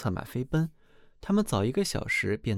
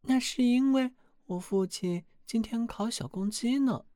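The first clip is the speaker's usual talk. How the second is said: much higher, cool princess voice; 12 semitones higher than usual. Acted. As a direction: tease the suitor coolly from her throne. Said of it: "国王女儿说道". (公主高声冷冷地说)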